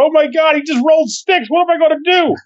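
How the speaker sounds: high, frantic game piece voice; mock panic. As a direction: shriek it like a terrified board piece.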